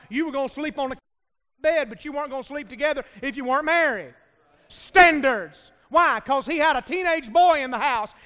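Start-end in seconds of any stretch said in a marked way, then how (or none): 4.7-6.2 loud, punchy one-word shouts; shouted emphasis. Real, not acted. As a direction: bark the word out to wake the room. Preacher shouting at congregation.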